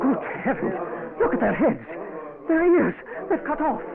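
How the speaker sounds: high, loud horrified cries; rising horror, loud and high. Acted. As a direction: recoil in shock at mutilated men. Professor sees spies' cut ears.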